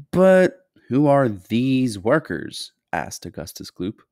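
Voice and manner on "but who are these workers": put-on inquisitive boy. A narrator's reading. Boy asks in story dialogue.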